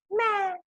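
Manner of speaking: high-pitched imitated bleat; lamb bleat. Lambs bleat after speaking.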